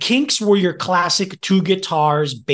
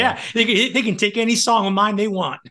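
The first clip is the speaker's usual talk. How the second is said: higher-pitched, quick mock voice; amused imitation, pitched up; joking quoted reply; 6 semitones higher, faster than usual. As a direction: lightly put on a high, agreeable voice.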